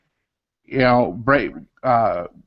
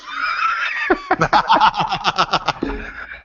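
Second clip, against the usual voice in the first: high, drawn-out burst of laughter; helpless high-pitched laughing fit; long laugh. Real, not acted. Reacting to the guitar banter.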